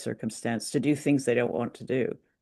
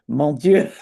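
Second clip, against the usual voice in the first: hammed-up French outburst, louder, with a laugh; playful louder mock-French cry; mock French exclamation; 7 dB louder than usual. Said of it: "the French must just listen to things like this and go".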